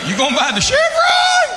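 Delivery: mock shocked yell. A local yokel yelling in disbelief at the comedian. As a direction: shriek it like a stunned local. Local shouting surprised question.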